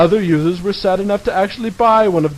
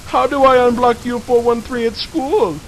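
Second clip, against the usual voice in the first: whiny noob voice; 7 semitones higher than usual. Retold or imitated. Quoted noob thread title.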